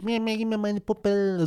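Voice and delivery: slow, drawn-out imitation of a babyish old patient; whiny baby. Care worker imitating an elderly patient begging for her doll, babyish. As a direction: imitate someone whining like a baby, stretched out. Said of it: "die war wie ein Baby".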